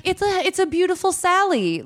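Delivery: high, sweet put-on mom voice; high mock voice. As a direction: chirp it like a proud cheap mom. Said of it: "She's like".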